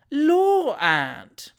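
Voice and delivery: high, loud mock protest; cheeky exclamation. A narrator's reading. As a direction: squeal it playfully at the aunt.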